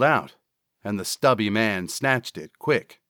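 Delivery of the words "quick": ordinary delivery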